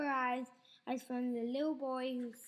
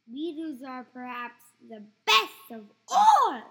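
gloating grandma; 13 dB louder than usual. Acted. Grandma relishing beetles.

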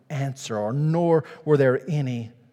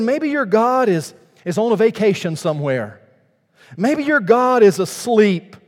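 mocking; 7 semitones higher, 6 dB louder than usual. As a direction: drag it out to ridicule them. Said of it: "Elijah mocked them".

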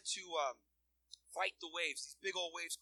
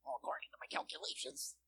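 nerdy whisper voice; 5 semitones higher, 5 dB quieter than usual. Pretend. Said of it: "Sorry, guys, I shouldn't have done that".

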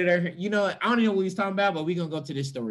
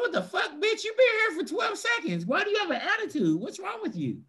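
much higher, outraged squeal of disbelief; exasperated high yell; 13 semitones higher than usual. Retold or imitated. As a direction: shriek your disbelief at the moody friend. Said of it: "I'm like".

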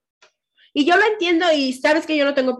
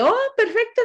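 much higher-pitched easy agreement; acted agreeable reply; 11 semitones higher than usual. Podcaster retelling her answer to a friend's suggestion, agreeable. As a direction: play back a high, accommodating yes.

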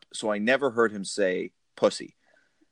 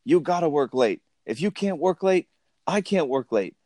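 higher, pushy boss rant, slowing at the end; mock yelling boss; 9 semitones higher than usual. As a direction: rant at the secretary like a bullying boss. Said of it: "when he's yelling at Grace".

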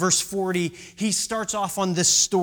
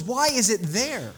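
ordinary talk, nothing marked

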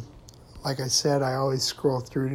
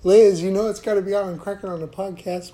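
calling out; 5 semitones higher, 6 dB louder than usual. Real, not acted. Calling out to neighbor.

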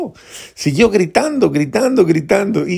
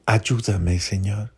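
humble plea; 4 semitones lower, 5 dB quieter, slower than usual. Acted.

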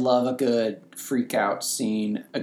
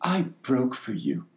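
quoted tearful line. Retold or imitated. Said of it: "I'm gonna quote it here".

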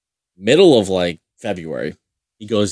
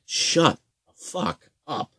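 mock goalie voice; 8 semitones higher, 3 dB quieter, slower than usual. Mocking the loud goalie.

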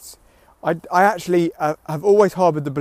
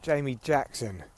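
usual speaking voice

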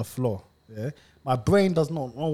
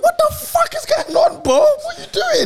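very high-pitched, incredulous demand; mock alarmed outburst; 5 dB louder than usual. Someone walking into his room and finding him hanging, high-pitched mock alarm. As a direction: squeal it in baffled alarm.

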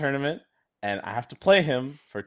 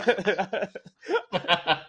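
laugh; 7 semitones higher than usual. Real, not acted. Laughs at own story.

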